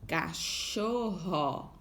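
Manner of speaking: cachorro said very slowly; slow, stretched. Shown as a demonstration. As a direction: draw out every syllable of cachorro. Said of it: "termina com esse som".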